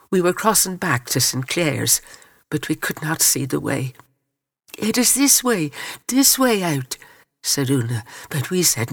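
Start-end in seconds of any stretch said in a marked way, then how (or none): 4.8-7.0 higher-pitched, mildly urgent narrated call. A narrator's reading. Una to the girls lost in smoke, urgent call. Urging others through the smoke.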